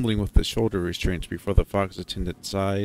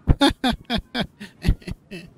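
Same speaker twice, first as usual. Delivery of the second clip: laugh; 13 semitones higher, 5 dB louder than usual. Acted. Nervous laugh on the ride.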